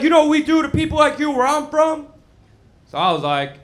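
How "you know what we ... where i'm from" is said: much higher, louder menacing threat; threatening friend. Retold or imitated. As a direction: threaten him loudly. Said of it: "he decided to threaten me".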